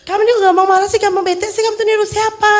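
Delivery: put-on a parent picking on the child's attitude; scolding parent. (orang tua menegur anak dengan nada tinggi)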